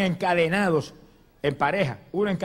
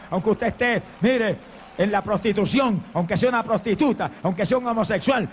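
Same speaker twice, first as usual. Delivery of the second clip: insistent, driving litany; urgent preaching; 4 semitones higher than usual. Real, not acted. Preacher offers sinners mercy tonight, urgent and insistent. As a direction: press each phrase on the congregation, gradually building. Preacher appeals directly to the congregation.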